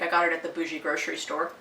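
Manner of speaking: ordinary talk, nothing marked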